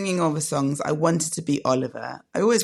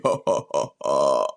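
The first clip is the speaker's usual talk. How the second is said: much lower, booming Father Christmas laugh; deep Santa ho ho ho; 14 semitones lower than usual. Pretend. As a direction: drop the voice into a big Santa belly laugh.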